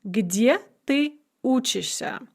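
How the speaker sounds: slow, clear teacher pronunciation; careful model sentence. Shown as a demonstration. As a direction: say it slowly for learners to copy. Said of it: "for example".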